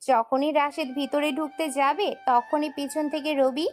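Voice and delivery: ordinary delivery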